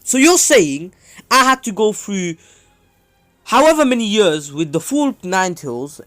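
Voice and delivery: high, loud, incredulous complaint; acted indignation. Kushina protests to Naruto about her Nine Tails half, acted loud indignation. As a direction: protest the unfairness at full voice.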